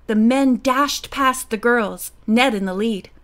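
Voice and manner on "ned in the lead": ordinary delivery, nothing marked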